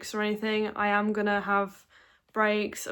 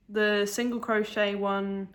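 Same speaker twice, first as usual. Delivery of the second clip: ordinary talk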